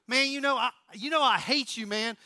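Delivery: put-on loud, high Southern accent as Ricky; mock quarrel in a pushed-up Southern accent; Southern movie-character accent. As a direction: play Ricky Bobby with a hammed-up Southern accent. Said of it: "how I can do that accent so well".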